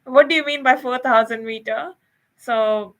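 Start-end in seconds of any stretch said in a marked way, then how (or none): 0.1-1.9 louder, higher, mock disbelief; incredulous scoff. Scoffing at the Snowy Mountains sign, loud incredulous disbelief.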